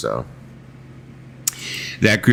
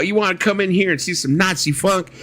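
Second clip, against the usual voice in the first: imitating Carl; 12 semitones higher than usual. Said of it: "He's all".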